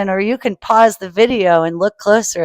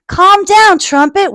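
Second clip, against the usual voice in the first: high, louder warning call; 10 semitones higher, 7 dB louder than usual. Acted. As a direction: warn him sharply in a high voice. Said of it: "warned Missus Trumpeter".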